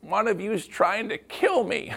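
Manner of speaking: high, hammed-up aunt at the table; Aunt Irma announcing. Aunt rising at holiday dinner, mock-dramatic high voice. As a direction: play the dramatic aunt with the pitch up. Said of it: "Aunt Irma stands up and says".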